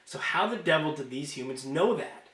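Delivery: ordinary delivery, nothing marked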